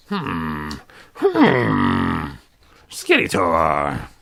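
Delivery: low, slow villainous hum and name; brooding Skeletor. Acted. Voicing Skeletor for viewers, low and brooding. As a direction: growl it out like Skeletor.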